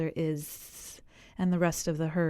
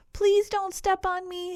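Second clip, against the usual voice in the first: much higher pleading snake voice; hissing snake; 12 semitones higher than usual. A narrator's reading. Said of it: "hissed the snake".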